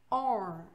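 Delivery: "or" with the R pronounced; American "or". Shown as a demonstration. English teacher shows students the word "or", American pronunciation. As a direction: say "or" the American way, R sounded. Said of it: "já os americanos".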